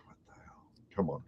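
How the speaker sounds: fast whispered complaint; whispered grumble. Retold or imitated. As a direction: mutter the complaint under your breath. Muttered frustration at border.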